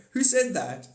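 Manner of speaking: high, loud startled demand; alarmed shout. Acted. World governments voiced.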